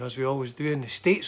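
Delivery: ordinary delivery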